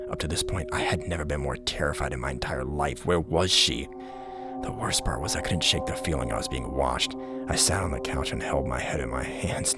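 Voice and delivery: ordinary delivery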